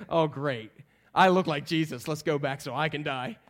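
low, deadpan dread; sarcastic. Acted. Thomas when they want to kill Jesus, glumly sarcastic. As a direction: sink into dry, resigned sarcasm. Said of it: "He's so sarcastic".

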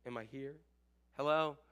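low, soft questioning, with waits between; mock call into silence, hushed and low; unanswered sender.

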